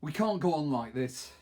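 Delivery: plain reading voice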